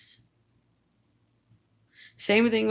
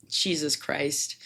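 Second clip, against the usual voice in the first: ordinary delivery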